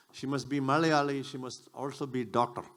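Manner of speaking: normal talking voice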